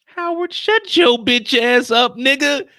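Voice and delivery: loud slow outburst at Howard; angry rant. Real, not acted. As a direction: bark it at the screen. Ranting at character Howard.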